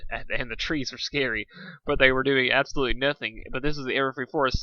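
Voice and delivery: ordinary delivery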